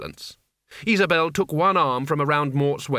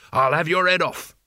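raised-voice threat; heated, semi-shouted warning; screamed threat; 3 semitones higher, 3 dB louder than usual. A narrator's reading. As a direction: yell it as a furious threat. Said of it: "he screamed".

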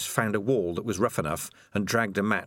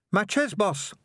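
lightly voiced rat henchman; 12 semitones higher than usual. A narrator's reading. Sardines voiced.

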